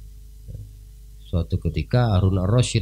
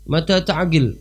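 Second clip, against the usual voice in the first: mocking king; 9 semitones higher than usual. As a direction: tease him as a madman. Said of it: "kata Harun Ar-Rasyid". (ejekan raja yang tinggi)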